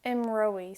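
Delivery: Imroi said as im-ROY, no y glide; Imroi without the Y. Shown as a demonstration. Teacher models Imroi for learners, careful pronunciation. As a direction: say Imroi cleanly, no Y sound. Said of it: "we don't pronounce it with the Y".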